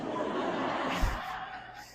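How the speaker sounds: a quick breathy laugh; burst of laughter. Real, not acted. Speaker laughing at his own joke, a quick laugh.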